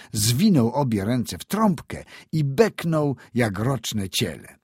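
ordinary delivery, nothing marked

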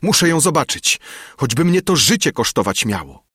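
high, desperate insistence; shouting. A narrator's reading. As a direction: cry it out as if staking life on it. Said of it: "krzyczałem".

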